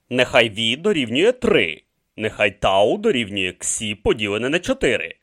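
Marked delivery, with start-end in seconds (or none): none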